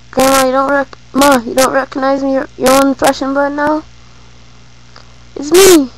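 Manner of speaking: high, loud, incredulous son; acted disbelief. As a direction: imitate a son protesting he isn't recognized, building to a loud finish. Retold son, not recognized by mother.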